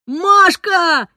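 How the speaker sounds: loud joyful call, pitched high; excited father shouting his daughter's name. A narrator's reading. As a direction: call her name across the room, overjoyed. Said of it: "кричал папа".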